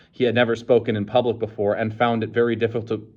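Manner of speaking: plain reading voice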